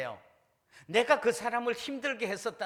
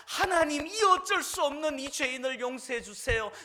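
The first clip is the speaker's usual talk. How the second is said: louder, higher cry to God for forgiveness; pleading prayer; 7 semitones higher, 5 dB louder than usual. Acted. Preacher voicing a sinner begging God's pardon, pleading loud. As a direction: plead aloud like a repentant sinner.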